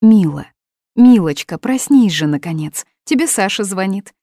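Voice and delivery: loud, urgent wake-up call; mother waking her daughter, loud and urgent; mother shaking her awake. Acted. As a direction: shake her awake loudly. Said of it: "тормошила Лидия".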